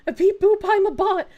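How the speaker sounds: high, loud beep-boop bot voice; robot voice. Pretend. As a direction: play a goofy robot. Mocking bot voice.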